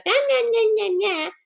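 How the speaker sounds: high sing-song nah nah teasing; mock a kid taunting a sibling. Child taunting sibling in a sing-song. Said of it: "one kid shows up and says".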